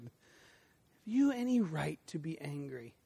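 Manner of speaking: God calmly questioning the angry prophet. Acted. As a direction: ask it gently and slowly, no heat. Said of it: "in a very calm way".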